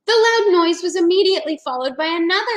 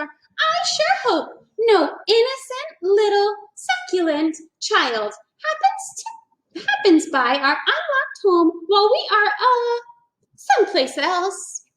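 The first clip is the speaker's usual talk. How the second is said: higher, slow sly dinosaur mom; higher, scheming mother dinosaur; Mama Dinosaur; 8 semitones higher than usual. A narrator's reading. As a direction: savour the words like a hungry mom.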